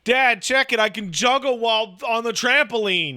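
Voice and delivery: put-on a kid begging dad to watch his trick; child calling out a stunt to dad; excited kid. Voicing future kid showing off.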